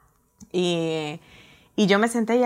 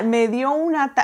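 usual speaking voice

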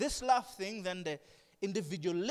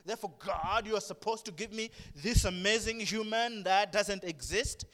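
ordinary talk, nothing marked

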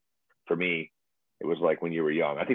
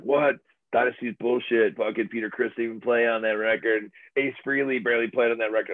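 higher, scornful fan voice; mock scoffing fan; 5 semitones higher than usual. Fans scoffing at Dynasty, mock scornful.

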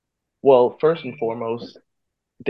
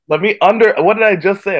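exasperated; 9 semitones higher, 9 dB louder than usual. Real, not acted. Defending his promise.

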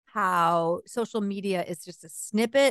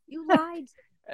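pitched-up retold accusation; higher mock yell; 8 semitones higher than usual. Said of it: "I'm yelling at him".

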